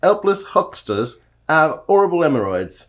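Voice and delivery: loud, hammed-up Cockney with no H's; broad, loud put-on Cockney; Cockney accent. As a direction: belt out the tongue-twister in mock Cockney. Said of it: "test of his Cockney accent".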